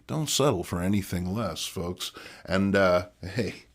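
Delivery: normal talking voice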